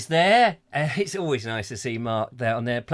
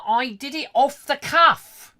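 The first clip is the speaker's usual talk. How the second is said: high, slow, louder mock boast; hammed-up quote; 9 semitones higher, 6 dB louder, slower than usual. Host voicing a listener's message, hammed-up. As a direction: call it out high and drawn out.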